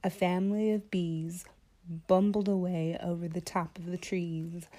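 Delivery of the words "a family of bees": ordinary delivery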